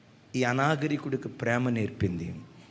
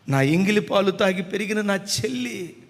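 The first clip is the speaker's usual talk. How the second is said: normal talking voice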